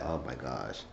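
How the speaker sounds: quiet. Retold or imitated. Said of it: "I was like".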